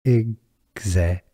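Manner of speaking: "Executor" broken into separate syllables with long gaps. Shown as a demonstration. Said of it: "The stress is on the Z syllable".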